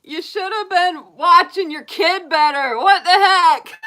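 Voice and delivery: loud, high laughing scold; scolding yell. Retold or imitated. Yelling at the TV character who lost his kid. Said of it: "I'm like".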